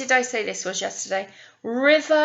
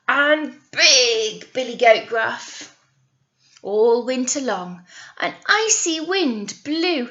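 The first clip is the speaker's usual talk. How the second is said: lively storybook reading voice; animated picture-book narrator; story narration; 2 semitones higher, 7 dB louder than usual. Acted. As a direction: read it out to children with energy. Reads story to children.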